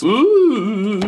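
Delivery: high, loud surprised ooh; ooh, pleasantly surprised. Real, not acted. Reacting to the product.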